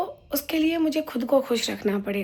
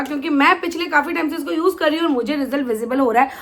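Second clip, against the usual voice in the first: ordinary delivery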